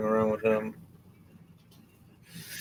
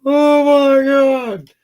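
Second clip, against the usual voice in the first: very high, loud, slow shriek; drawn-out shriek; 12 dB louder, much slower than usual. Acted. Robot lands next to him, a long very high terrified shriek. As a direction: stretch it into a terrified squeal. Nearly squished by landing robot.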